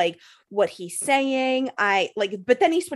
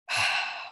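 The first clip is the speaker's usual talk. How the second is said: long breathy exhale of dismay; exasperated sigh; 6 dB quieter than usual. Retold or imitated. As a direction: sigh as if at a loss. Retold dismay at no elevator.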